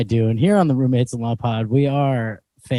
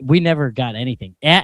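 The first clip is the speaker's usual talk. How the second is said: normal talking voice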